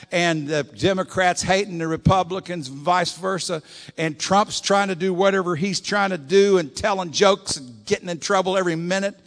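fiery sermon climax; preaching at full force. Acted. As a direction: thunder it at the congregation. Preacher builds over world's chaos.

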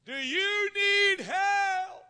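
high, very drawn-out heavenly call; voice from above. Acted. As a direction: intone it slowly as a voice from on high. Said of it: "and the voice says".

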